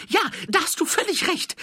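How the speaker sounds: very high, loud put-on voice; eager petty thief. A narrator's reading. Thief agreeing with the watchman, high and loud. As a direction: agree in a high, excited shout.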